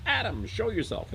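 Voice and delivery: high, commanding summons; God's high-pitched call. Acted. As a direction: order someone out of hiding. God calling Adam out of hiding.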